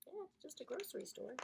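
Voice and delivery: quick, soft mock repeat; mocking echo. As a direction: tease him by parroting. Narrator mocks Snapsy.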